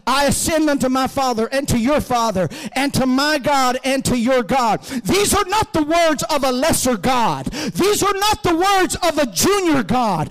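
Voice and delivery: building to a high, ringing shout; full-force preaching. Real, not acted. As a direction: hammer each denial home to the congregation.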